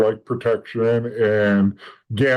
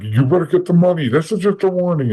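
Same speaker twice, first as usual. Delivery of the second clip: raised, higher menacing warning; threatening warning; 6 semitones higher, 4 dB louder than usual. Retold or imitated. Enforcer warns a beaten debtor, loud and menacing. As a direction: lean in and threaten the guy on the ground.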